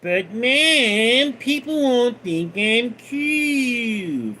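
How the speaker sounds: whiny, slow, high cartoon boy complaint; slow, whiny cartoon brat; Cartman voice. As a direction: pout it out like a spoiled kid. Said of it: "I was doing Cartman".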